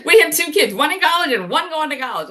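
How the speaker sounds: high, loud, incredulous retort; exasperated. Retold or imitated. Telling her husband they have two kids, exasperated and loud. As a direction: pitch it up and push it, almost shouting at him.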